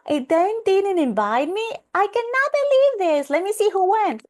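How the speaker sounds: high, loud mock outrage at a social media post; shocked gasp. Herself scrolling, shocked at a wedding she missed, high mock outrage. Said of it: "So I was like".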